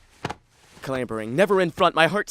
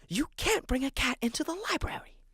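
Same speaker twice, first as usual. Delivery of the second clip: stern library whisper, higher; hushed scolding librarian; 7 semitones higher, 6 dB quieter than usual. Acted. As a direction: scold without raising your voice.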